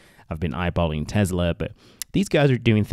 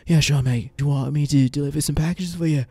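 high, loud put-on British accent; hammed-up British accent, higher than usual; 8 semitones higher, 4 dB louder than usual. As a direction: do a cheeky mock-British voice, pitched up. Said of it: "put on my British accent".

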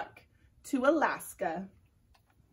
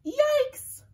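higher, louder squeaky yelp; germ yelp; 6 dB louder than usual. Acted. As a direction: squeal as it spins away. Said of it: "screamed the germ".

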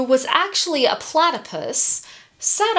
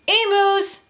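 high, short answering call; calling back; 10 semitones higher, 3 dB louder than usual. A narrator's reading. Kangaroo to kookaburra overhead, calling back high. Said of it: "the kangaroo called back".

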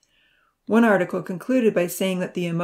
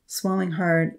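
ordinary delivery

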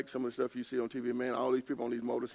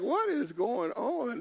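slow bewildered disbelief; 10 semitones higher, much slower than usual. Retold or imitated. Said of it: "I'm like".

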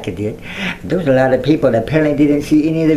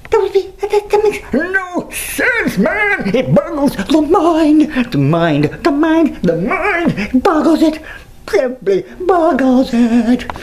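high, slow, hammed-up boggled voice; mock bewilderment; 12 semitones higher, slower than usual. Vlogger ranting about pointless notifications, high slow mock daze. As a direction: play it like a dazed cartoon.

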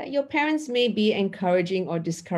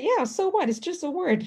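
higher, casual shrug; shrugging it off; 9 semitones higher than usual. Retold or imitated. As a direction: lightly toss it aside, higher. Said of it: "I said".

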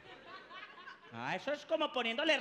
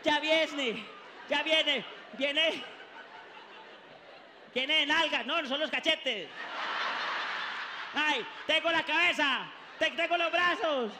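loud, high, hurried announcing; excited doctor; 5 semitones higher, 4 dB louder than usual. Retold or imitated. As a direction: call out each body part. Doctor announcing baby's arrival.

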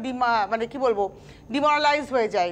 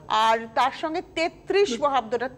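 ordinary talk, nothing marked

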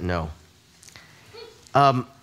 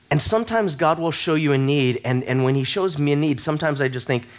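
ordinary talk, nothing marked

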